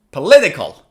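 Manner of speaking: "political" with LIT punched loud; "political" peaking on the second syllable; stress on second syllable. Shown as a demonstration. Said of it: "Stress is on the second syllable".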